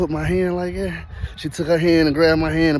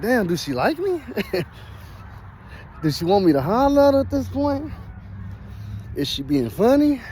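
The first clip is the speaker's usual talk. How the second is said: confused; 6 semitones higher than usual. Retold or imitated. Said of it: "I'm confused now".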